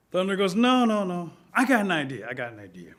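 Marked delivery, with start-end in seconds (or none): 0.5-2.3 high, loud burst of an idea; excited interruption. Acted. Thunder rejecting small sticks with a new idea, excited. 2.3-2.9 low, soft scheming aside; lowered repeat. Acted. Thunder mulling his new idea, hushed.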